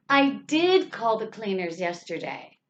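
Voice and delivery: I did call, with did exaggerated; stress on did. Shown as a demonstration. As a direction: lean hard on did, letting the rest fall away. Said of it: "we want to exaggerate or stress".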